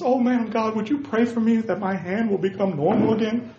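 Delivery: high-pitched begging voice; hammed-up high supplication; mock pleading. Acted king begging prophet for prayer.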